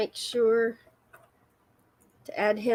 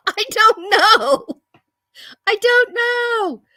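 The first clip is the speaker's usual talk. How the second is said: high drawn-out shrug; 9 semitones higher, 8 dB louder, slower than usual. Pretend. Playful high drawn-out repeat.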